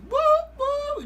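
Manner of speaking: high-pitched party "woo"; festival whooping. Retold or imitated.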